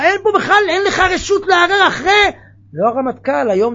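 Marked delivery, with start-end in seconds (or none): 0.0-2.3 loud, raised proclamation of the rule; preacher's raised decree; forceful declaration. Acted. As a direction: thunder it out like a decree.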